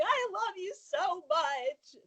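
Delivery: high, drawn-out mock wail; mock sobbing. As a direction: imitate a fan sobbing with adoration. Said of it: "when she starts sobbing".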